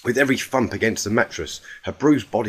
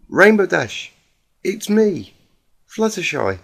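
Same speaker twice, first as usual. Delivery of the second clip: higher, timid voice of a gentle pony; gentle Fluttershy; 8 semitones higher than usual. Acted.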